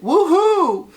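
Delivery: high loud whoop; cheering woohoo. Pretend. Cheering after good news.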